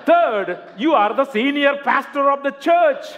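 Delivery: loud, high, laughing through the reveal; punchline blurted out with a laugh. Real, not acted.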